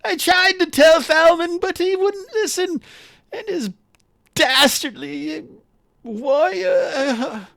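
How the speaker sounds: very high, wailing hammed-up voice; Urks lamenting. Game master as poisoned Urks begging help, tearful wail. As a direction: moan and sob theatrically in a high pitch, loud then faltering. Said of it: "but Urks is like".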